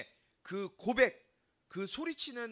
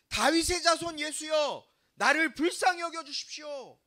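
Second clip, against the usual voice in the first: shouted plea; 5 semitones higher, 8 dB louder than usual. Acted. Preacher as blind Bartimaeus shouting to passing Jesus, crying out loudly. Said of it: "소리치는 거예요".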